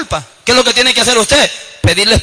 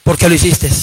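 lowered, reproachful inner voice; lightly voiced guilty conscience, pitched low; accusing conscience; 5 semitones lower than usual. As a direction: question them like a nagging conscience. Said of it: "ahí anda la conciencia".

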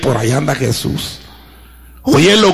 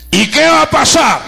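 grab the congregation's attention; preacher's shout; 9 semitones higher than usual. Real, not acted. Rhetorical shout in sermon.

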